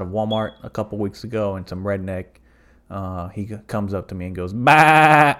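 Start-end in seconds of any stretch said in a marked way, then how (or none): 4.5-5.4 loud, high sheep "baa"; mocking sheep bleat. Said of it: "like a fucking sheep".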